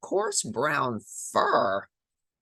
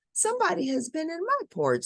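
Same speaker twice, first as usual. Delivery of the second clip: higher, quick mother bear voice; Mama Bear voice; faster than usual. Acted. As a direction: fuss like a startled mother bear.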